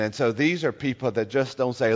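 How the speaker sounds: ordinary talk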